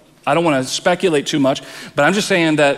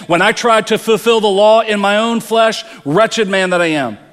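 much higher and louder, "wretched man that I am" cried out in despair; anguished outcry; 6 semitones higher, 4 dB louder than usual. Retold or imitated. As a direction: shout it as Paul failing under the law. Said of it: "he's saying".

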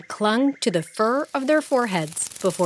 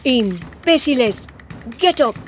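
higher and louder, raging; furious scolding; 5 semitones higher, 3 dB louder than usual. Acted. The Monkey King raging at his fallen soldiers. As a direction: bark at the fallen troops.

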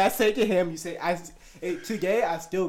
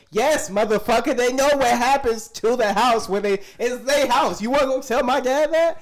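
higher and louder, fired up; heated comeback; 6 semitones higher, 5 dB louder than usual. Real, not acted. Firing back at people who judge his parents, heated and loud.

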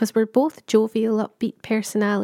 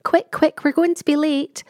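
put-on higher, pushy child's voice hurrying mum; hurried child urging mum; 5 semitones higher than usual. Child hurrying mum out the door, higher and pushy. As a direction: chivvy her along like an impatient kid. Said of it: "or someone says".

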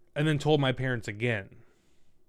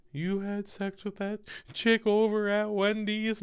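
mock accusing girlfriend; 9 semitones higher, slower than usual. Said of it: "And she's like".

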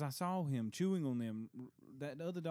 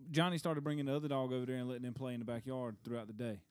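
usual speaking voice

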